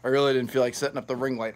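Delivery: normal talking voice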